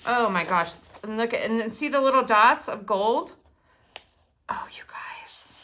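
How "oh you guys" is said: slow, soft whisper of delight; awed whisper. Real, not acted. Showing gold dots to viewers, whispered awe. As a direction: breathe it out in wonder.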